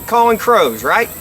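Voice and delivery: ordinary talk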